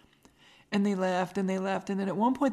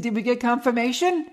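higher, louder rising question; eager retold question; 4 semitones higher, 7 dB louder than usual. Host retelling her question to coworkers, loud astonished asking. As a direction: burst out asking, pitch climbing.

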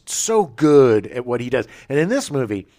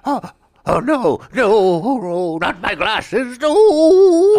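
high, loud, drawn-out mock wail; anguished bookworm; 11 semitones higher, 9 dB louder, much slower than usual. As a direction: wail in despair, stretching the last word into a long howl. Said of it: "Time Enough at Last, about the bibliophile".

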